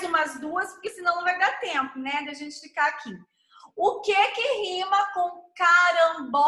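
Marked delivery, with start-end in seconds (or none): 5.6-6.5 "carambola" stretched out, syllable by syllable. Shown as a demonstration. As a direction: pull "carambola" apart, long on "bo" and "la". Sounding out the next rhyme word.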